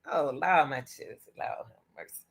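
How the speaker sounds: fading to a breathy whisper; awed murmured Lord have mercy; whispered prayer. Real, not acted. Reacting to sad news.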